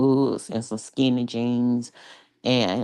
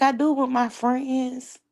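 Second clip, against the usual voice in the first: quoting herself; 11 semitones higher than usual. Retold or imitated. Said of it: "And I was like".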